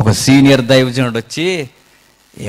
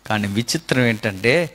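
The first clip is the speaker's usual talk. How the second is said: usual speaking voice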